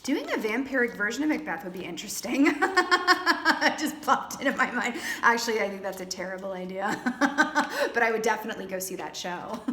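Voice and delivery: amused, words breaking into laughter; giggling through the thought; laughing. Real, not acted. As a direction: laugh at your own silly idea. Amused by her own vampire idea.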